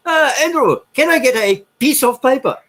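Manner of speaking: high, bright imitation of a French accent. Backpacker retelling Thierry at the bar in a high French accent. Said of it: "French crazy bastards".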